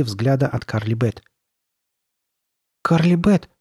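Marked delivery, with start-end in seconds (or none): 2.8-3.5 put-on higher, louder, hesitant boy's question; forced out. A narrator's reading. Frightened boy to a masked figure, forced out. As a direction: squeeze the question out in a high voice. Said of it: "наконец выдавил он".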